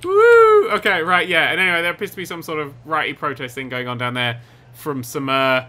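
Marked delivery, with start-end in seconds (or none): 0.0-0.7 very high, loud drawn-out "woo"; whoop. Real, not acted. Player whooping while testing the jump, loud whoop. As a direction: let out a big high whoop.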